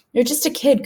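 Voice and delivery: put-on boy protests to the ghost; protesting. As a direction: push back in disbelief. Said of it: "Cooper protests".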